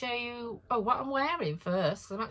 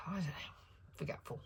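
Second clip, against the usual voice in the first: soft whisper, trailing away; whispered aside; 12 dB quieter than usual. Real, not acted. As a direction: mutter it to yourself quietly. Self-deprecating trailing off.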